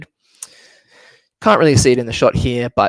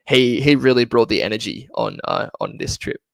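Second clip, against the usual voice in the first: normal talking voice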